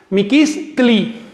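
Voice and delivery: "Miquis tli" said very slowly with a clear break before tli; Miquis tli split slowly. Shown as a demonstration. Teacher spelling out the suffix for the class, slow and syllabic. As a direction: drag out "Miquis tli", tli set apart. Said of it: "aplicamos el sufijo tli".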